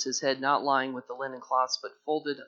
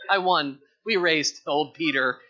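loud, mocking brag, slow on the first words; teasing victory gloat; 8 dB louder than usual. John teasing Peter after winning the race, loud and gloating. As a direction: rub it in to the loser. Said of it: "That's what he's saying".

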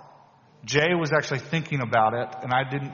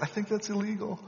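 high, softer mock-worried voice; retold hesitant objection; 9 semitones higher, 5 dB quieter than usual. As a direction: object gingerly in a raised pitch. Retold uneasy reply to confession.

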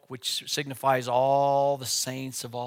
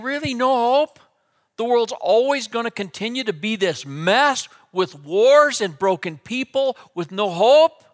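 anguished plea; 8 semitones higher, 8 dB louder than usual. Acted. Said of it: "John begins to weep greatly".

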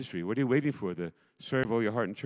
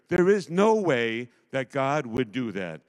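ordinary delivery

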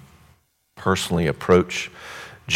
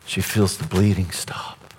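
hushed whisper; 8 dB quieter than usual. Acted. Preacher whispering to the congregation at the healing. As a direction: whisper it, barely audible, slowing right down.

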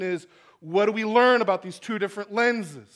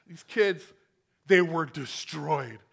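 ordinary talk

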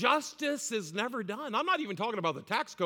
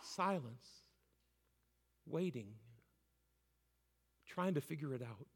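much softer and lower; hushed; 6 semitones lower, 11 dB quieter than usual. Real, not acted. Preacher falling quiet after the martyrs' cry, hushed. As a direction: drop to a hush after the cry.

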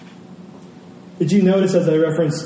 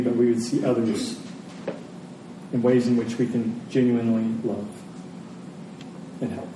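quiet prayer; 3 semitones lower, 4 dB quieter than usual. Acted. Pastor closing the sermon in prayer, quiet and reverent. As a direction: pray softly over the congregation.

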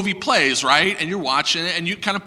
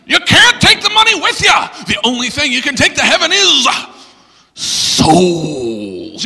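preacher; 8 semitones higher, 4 dB louder than usual. Retold or imitated. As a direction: parody a fired-up preacher. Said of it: "you heard preachers say this".

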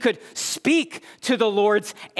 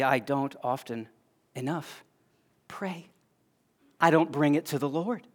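quiet confession; 4 semitones lower, 6 dB quieter than usual. Real, not acted. Confessing failure to pray.

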